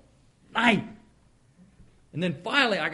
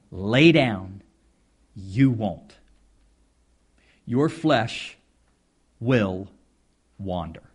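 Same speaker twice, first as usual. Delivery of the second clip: forceful preaching; 9 semitones lower, 6 dB louder, slower than usual. Acted. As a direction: lay punched loud, a firm command. Preacher hammers the point.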